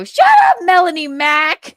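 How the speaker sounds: hammed-up exasperated shout; mock shout. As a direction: scream it in fake frustration. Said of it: "I didn't go".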